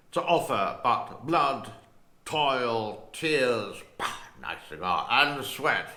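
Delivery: slow, booming British Churchill delivery; Churchill accent. Retold or imitated. Vlogger in character as Churchill, slow booming British accent. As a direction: declaim it like Churchill in Parliament. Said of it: "my Winston Churchill accent".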